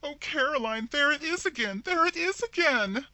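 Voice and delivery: very high, loud wailing cry of terror; terrified sister wailing as the shadow returns; wild wail. A narrator's reading. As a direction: wail it out in panic. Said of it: "burst out in a wild wail".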